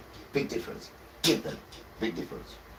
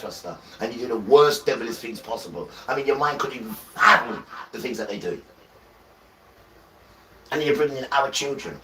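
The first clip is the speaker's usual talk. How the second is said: higher, louder preacher's challenge; fiery preaching; 4 semitones higher, 4 dB louder than usual. Acted. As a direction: call the enemy out from the pulpit. Preaching challenge at full force.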